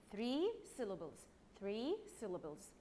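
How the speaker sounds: ordinary talk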